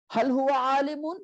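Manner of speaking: "hal huwa alimun" high, lifted at the end; question. Shown as a demonstration. Teacher showing the second question form, high rising "hal huwa alimun". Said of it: "change your jumlatul ismiya into a questioning".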